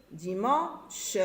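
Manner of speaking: dimanche split into diman, pause, che; split final sound. Shown as a demonstration. Teacher showing the consonant in dimanche, final che separated. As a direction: say "Dimanche" with the ch set apart. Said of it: "j'ai le son ch".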